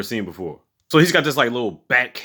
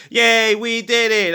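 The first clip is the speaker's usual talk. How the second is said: higher, slow sing-song mock celebration; mock cheer; 10 semitones higher, much slower than usual. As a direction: drawl out a fake victory cheer. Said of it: "They like".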